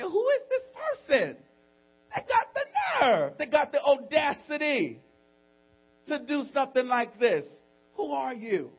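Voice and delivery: very high indignant cry; hammed-up high-pitched outrage; outraged. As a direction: shriek it in affronted disbelief. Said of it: "David was outraged by what he saw".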